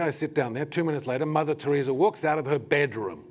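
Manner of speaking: ordinary talk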